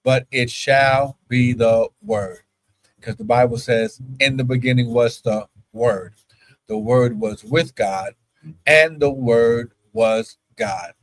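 slow, measured scripture reading; preaching. Acted. Preacher reading the Bible to his congregation, measured. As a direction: preach the verse slowly and low.

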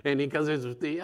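ordinary talk

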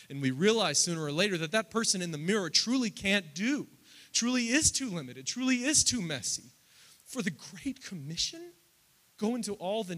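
high, loud preaching climax; rising pulpit fervour, voice lifted high; full-force preaching. Acted. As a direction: build it to a loud pulpit peak.

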